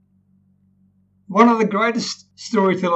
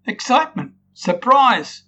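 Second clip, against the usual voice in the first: high, loud, delighted word by word; excitement; 7 semitones higher, 4 dB louder than usual. Shown as a demonstration. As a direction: call out each word with rising joy. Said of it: "all showing in the tone".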